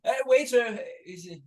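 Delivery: high, fussy upper-class gent; posh gentleman voice. Acted. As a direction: play the anxious posh date. Calling waiter in role.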